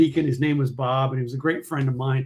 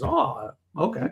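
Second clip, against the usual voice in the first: slow, higher dawning acceptance; puzzled old man; 9 semitones higher, 4 dB quieter, slower than usual. Retold or imitated. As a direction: slowly accept it, a bit lost. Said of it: "He goes".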